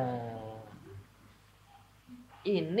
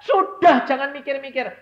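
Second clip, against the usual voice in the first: high, loud pleading evangelist; woman evangelist; 16 dB louder than usual. Retold or imitated. Woman evangelist urging the congregation, loud high appeal. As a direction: urge them like a woman evangelist. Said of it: "seorang penginjil perempuan yang dia berkata".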